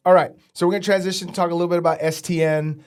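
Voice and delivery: usual speaking voice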